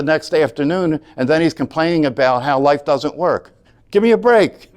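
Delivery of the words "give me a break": higher, louder scoff of disbelief; exasperated. Real, not acted. Teacher scoffing at his stepson's excuses, exasperated. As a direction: throw up your hands at it.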